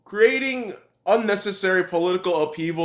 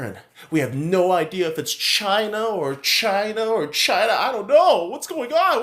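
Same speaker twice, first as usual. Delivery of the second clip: mocking believers; 4 semitones lower than usual. Ranter mimics vaccine believers fretting over the news, mocking.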